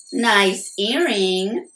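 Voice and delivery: slow, pointed sarcasm; slow sarcastic sister; snide jab. A narrator's reading. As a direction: drag it out to expose him. Sister sniping at brother.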